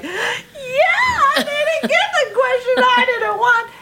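very high, slow, laughing shout; excited laughing shout. Retold or imitated. Dancer thrilled by the audition question, laughing shout. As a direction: burst out with excited glee.

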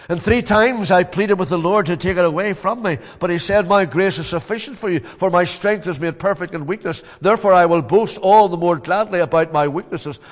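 loud, ringing sermon voice; preaching at full force. Acted. Preacher voicing Paul's testimony, loud and fervent.

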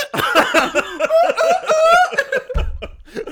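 loud high laugh. Real, not acted. Big laugh after the mock voice.

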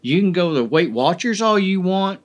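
ordinary talk

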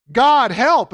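loud, high call for help; desperate cry. Acted. Moses crying out to the Lord, a loud high plea. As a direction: cry out to God in alarm.

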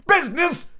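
high anguished ghostly cry. Acted. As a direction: fling the word out in despair. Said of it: "cried the ghost".

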